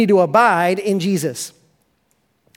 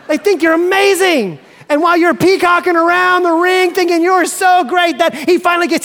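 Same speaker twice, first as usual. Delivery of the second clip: climbing higher and louder into a near shout; preacher to congregation, building to a triumphant shout; rising excitement; 8 semitones higher, 4 dB louder than usual. Acted.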